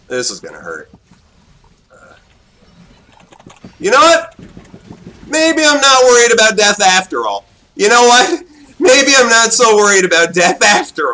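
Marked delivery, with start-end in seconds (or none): 3.8-11.1 higher, louder, sing-song gloat; gleeful taunt. Real, not acted. Gamer gloating after reviving, raised and playful. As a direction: gloat it to yourself.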